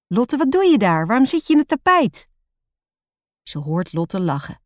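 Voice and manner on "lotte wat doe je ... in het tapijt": put-on high, quickening child's questions; astonished question. A narrator's reading. Roos finding Lotte rolled up in the rug, astonished. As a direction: ask in baffled surprise, pitch up high.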